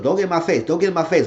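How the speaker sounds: mock confrontational warning; heated words. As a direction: imitate someone squaring up in an argument. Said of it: "they were going words".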